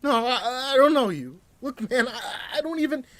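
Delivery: high, loud, panicked denial; breathless scared protest; gasping fear. Acted. Hostage denying to the knifeman, high and gasping. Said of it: "He gasped".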